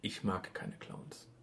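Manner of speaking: soft and slow, almost breathed; hushed confession. Real, not acted. Admitting to his co-host that he dislikes clowns, said in a hushed voice. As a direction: say it low, like a guilty secret.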